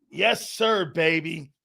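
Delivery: normal talking voice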